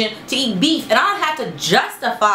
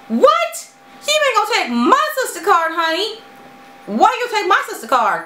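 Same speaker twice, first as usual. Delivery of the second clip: indignant retort; 10 semitones higher than usual. Real, not acted. Answering the critic who questioned her sisterhood, sassy and high. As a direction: shoot it back way up high.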